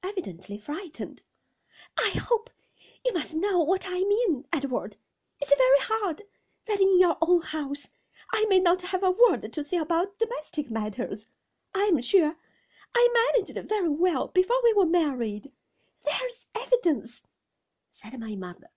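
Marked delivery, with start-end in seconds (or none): 2.0-15.5 high, loud, distressed protest; aggrieved wife pleading. A narrator's reading. Mother defending her housekeeping to her husband, high and distressed. 16.0-17.2 high, tearful protest; narrated sobbing. A narrator's reading. Mother appealing to her husband, high and tearful.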